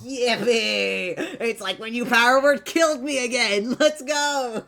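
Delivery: loud, high whoop of joy; excited cheering. Real, not acted. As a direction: cheer it out, thrilled. Cheering a death saving throw.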